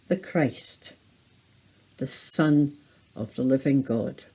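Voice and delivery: slow, spaced words with pauses; slow signing recital. Shown as a demonstration. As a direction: read out slowly, word by word, for signing. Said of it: "sign that together".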